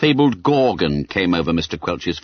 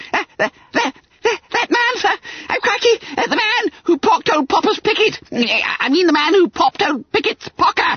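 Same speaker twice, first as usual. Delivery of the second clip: very high squeal, tripping over words; babbling with terror. A narrator's reading. Terrified pupil describing the pickpocket to schoolmaster, babbling. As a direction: stammer and muddle it in panic. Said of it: "He was fairly babbling with terror".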